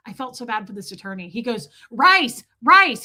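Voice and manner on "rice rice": loud, high single-word yells of "Rice"; imitating shouted advice. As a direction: bellow "Rice" like a caller down the line. Attorney shouts advice over phone.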